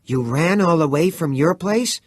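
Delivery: can't believe he ran that far; disbelieving. A narrator's reading. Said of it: "Chris asked unbelievingly".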